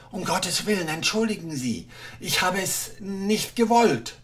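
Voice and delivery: nervous little official begging; timid clerk. Acted.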